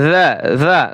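usual speaking voice

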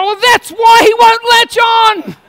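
high, loud, slowed-out retort; exasperated mock shout. Husband sees baby upside down at the breast, mock exasperated shout. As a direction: cry out the obvious in mock exasperation. Acted exasperated reply to wife.

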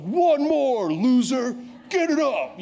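high, loud barking coach voice; mock gym bro shout. Gym bro barking at a struggling lifter, high and loud. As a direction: yell it like a mocking gym bully. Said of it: "that super fit dude".

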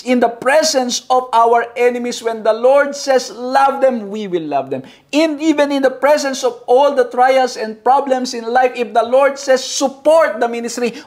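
preacher rousing the church; fervent preaching. Acted. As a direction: drive the point home from the pulpit. Preaching at full force.

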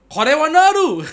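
high, loud, over-the-top anime yell; hyped anime shout. Pretend. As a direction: belt it like a fired-up anime fan. Anime joke while reading wishes.

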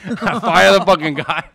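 loud, high, laughing retelling; cracking up as he tells it. Real, not acted. Laughs while retelling the firing.

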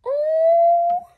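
sharp gasp of delight. Real, not acted. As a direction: gasp into a high, loud oh. Sees a cute toy.

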